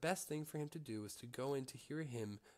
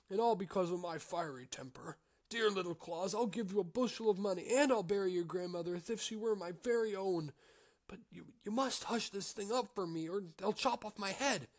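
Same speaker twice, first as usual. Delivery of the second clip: pleading innkeeper; 8 semitones higher, 6 dB louder than usual. Acted. Innkeeper begging Little Claus.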